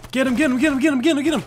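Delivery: high, loud rapid-fire chant; frantic urging. Real, not acted. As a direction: egg a teammate on excitedly. Urges on teammate in fight.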